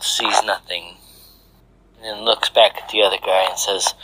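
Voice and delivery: plain reading voice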